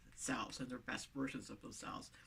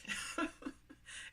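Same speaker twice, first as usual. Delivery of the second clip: airy little laugh, no voice; soft breathy laugh; 5 dB quieter than usual. Real, not acted. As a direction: laugh quietly under her breath. Laughs at own joke.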